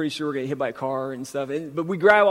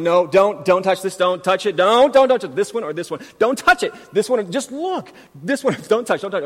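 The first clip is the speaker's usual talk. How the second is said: higher, louder, panicky dad pleading; frantic warning; 4 semitones higher, 7 dB louder than usual. Retold or imitated. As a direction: shout it like the kids are near breaking glass. Dad warning kids in glass aisle.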